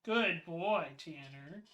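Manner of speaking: quiet, gentle praise trailing to nothing; soft praise for the dog. Real, not acted. Streamer softly praises his dog Tanner.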